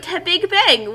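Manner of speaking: ordinary talk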